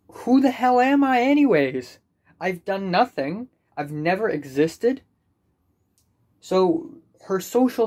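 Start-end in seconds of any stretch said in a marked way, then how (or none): 0.1-2.0 loud, high self-mocking cry; real exasperated outburst; exasperated outcry. Real, not acted. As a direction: throw the question up in despair.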